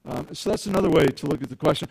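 usual speaking voice

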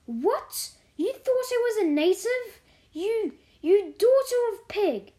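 high, loud outraged outburst; furious. A narrator's reading. Mary mistaken for a native by the maid, furious. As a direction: hurl the insult, pitched high and loud.

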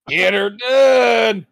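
slow, drawn-out shout of "Get her done"; catchphrase shout. Pretend. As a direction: bellow the catchphrase like a crowd cheer.

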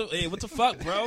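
outraged yell. Retold or imitated. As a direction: shout it like a pissed-off kid. Acting out the pissed kid.

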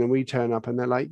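normal talking voice

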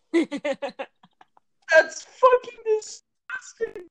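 high, slow laughing cry; disgusted laughing. Real, not acted. Laughing at gross image.

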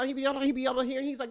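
high, nasal-sounding old man imitation; grandpa's voice. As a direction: do grandpa's "no, no" up in the nose. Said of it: "This is how my grandfather sounds".

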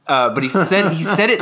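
ordinary talk, nothing marked

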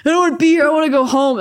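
mock her own childish complaining; whiny sulk. Retelling her sulky teenage self.

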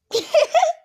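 loud high burst of laughter. Real, not acted. Laughs at her own mistake.

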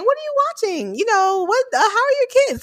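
a touch of a bright, high polite voice; friendly workplace small talk. Retold or imitated. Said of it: "if we're saying".

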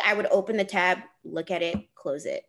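normal talking voice

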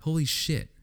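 exasperated. Retold or imitated. Venting about people not sitting in seats, exasperated. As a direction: pitch it up, fed up with them.

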